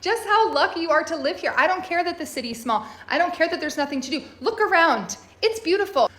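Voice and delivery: bursting to shake sense into them; exasperated plea. Pretend. Pleading with complaining Germans, exasperated and excited. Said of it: "I just wanna squeeze you and be like".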